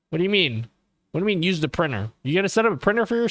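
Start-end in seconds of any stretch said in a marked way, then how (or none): none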